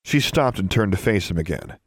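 plain reading voice

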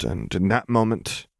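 ordinary delivery, nothing marked

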